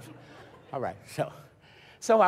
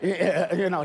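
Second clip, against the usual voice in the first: ordinary talk, nothing marked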